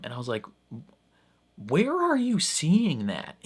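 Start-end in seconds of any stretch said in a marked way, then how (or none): 1.6-3.4 high-pitched, exasperated disbelief; incredulous. Retold or imitated. Vlogger answering online viewers' take on the scene, incredulous. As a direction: ask it in baffled protest, pitch leaping up. Reacting to baffling online opinions.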